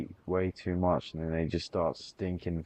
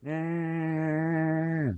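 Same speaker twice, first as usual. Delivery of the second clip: high held falsetto; falsetto yeah; 11 semitones higher than usual. Pretend. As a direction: stretch it out up high. Falsetto reply.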